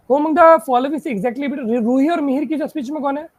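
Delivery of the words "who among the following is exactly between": slow, measured clue reading. Shown as a demonstration. Teacher dictating the next clue to the class, slowly. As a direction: read each word out carefully. Reads the question aloud.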